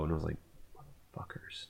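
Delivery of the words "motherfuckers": whispered curse under the breath. Retold or imitated. Narrator whispering a curse about the couple. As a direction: mutter it so they can't hear.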